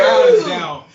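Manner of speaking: whooping high echo of a heckle; high-pitched shout. Acted. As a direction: throw the heckle back way up high. Echoes audience shout for laughs.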